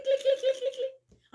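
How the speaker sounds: mock clicking noise. Imitates eyes clicking, laughing.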